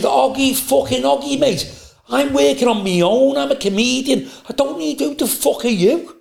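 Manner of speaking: ranting back in mock fury; retold angry rant.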